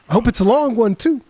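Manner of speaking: lightly voiced mocking quote; Eddie Murphy line. A hint of Eddie Murphy sneering at his cellmate. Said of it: "the way that he goes".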